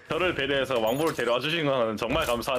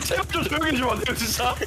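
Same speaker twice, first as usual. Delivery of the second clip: pleading; 11 semitones higher, faster than usual. Real, not acted. Begging over the noise.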